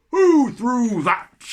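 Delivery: slow, loud, high roar; yeti roar. Acted. The biggest yeti hit in the face, roaring loud. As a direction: bellow it like a furious yeti. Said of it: "roared the Yeti".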